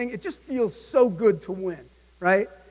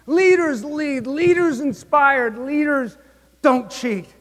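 slow, higher, each phrase punched; emphatic mantra; 5 semitones higher, 3 dB louder, slower than usual. Real, not acted. Speaker rallies sales crowd with slow, punched slogans. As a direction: hammer home each rule. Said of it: "slow leadership mantra".

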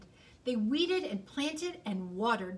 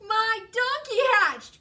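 high, loud cry of delight; joyful cry; 11 semitones higher, 9 dB louder than usual. Acted. Bear seeing the egg crack, crying out with joy. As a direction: burst out with amazed joy. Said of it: "cried Bear".

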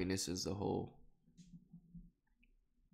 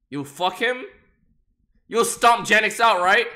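normal talking voice

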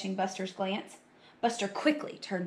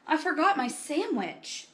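higher, plaintive admission; sad; 7 semitones higher than usual. A narrator's reading. Buster telling friends about missing lunch, sad. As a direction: lament it like a disappointed child. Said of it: "Buster said sadly".

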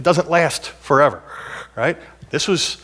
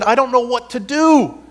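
despairing quote; 9 semitones higher than usual. Retold or imitated. Quoting congregation's despair.

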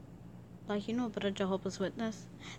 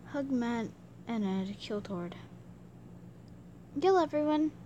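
ordinary talk, nothing marked

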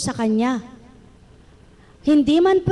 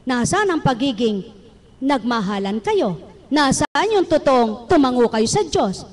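usual speaking voice

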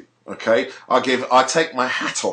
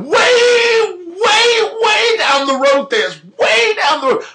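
much higher, louder yelled outrage; outraged shout; 6 dB louder, slower than usual. Real, not acted. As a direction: shout it out, fully fired up. Ranting about paedophilia.

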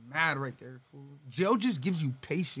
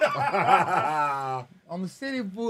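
laughing; 3 dB louder, much slower than usual. Real, not acted. Laughing at cousin joke.

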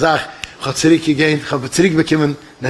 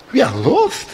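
questioning, pitched up in disbelief; incredulous echo; 6 semitones higher, slower than usual. Acted. Passenger repeating the pilot's announcement, incredulous.

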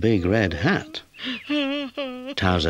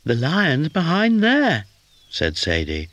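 very high, slow worried kitten; small scared kitten pointing; 11 semitones higher, slower than usual. A narrator's reading. Kitten whispering to Towser where the lion sleeps, worried.

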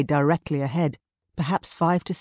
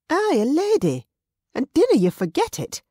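proud retort; 12 semitones higher, 6 dB louder than usual. Acted.

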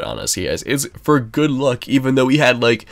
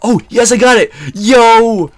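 high, loud victory yell; triumphant shout; 11 semitones higher, 9 dB louder than usual. Real, not acted. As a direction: cheer the kill at the top of the voice. Triumph at the kill.

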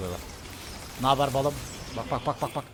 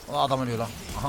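ordinary talk

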